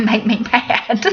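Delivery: amused, slowed through a laugh. Real, not acted. Mother joking to students about her kids, laughing. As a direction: tease it while laughing.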